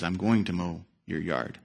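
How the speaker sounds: steady and gentle, won't be shaken; quietly firm old neighbour; calm old man. Retold or imitated. Old man doesn't blink.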